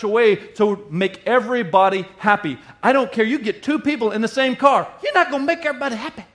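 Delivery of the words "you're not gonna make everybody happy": high, very fast exclamation; exasperated aside. Acted. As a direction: blurt it out high and rushed. Exasperated punchline, then repeated.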